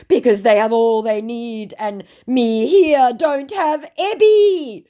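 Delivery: high, slow, pitiful whine; mock sob story voice. Scammer pleading poverty to the narrator, pitiful high whine. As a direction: lay the guilt on thick and high. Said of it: "he said".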